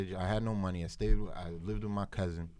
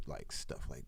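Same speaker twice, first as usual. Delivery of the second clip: ordinary talk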